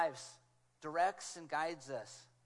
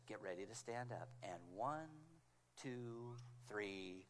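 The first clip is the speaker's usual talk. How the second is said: quiet, lower, steady cueing; gentle backstage countdown; soft countdown; 10 semitones lower, 11 dB quieter, slower than usual. Retold or imitated. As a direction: count him in calmly. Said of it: "It was that clear".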